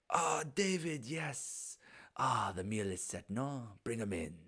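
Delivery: lightly voiced genteel host; a hint of a foreign host, high at first; host greeting guests. Host welcoming guests to the meal, lightly voiced. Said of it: "him kinda look up and goes".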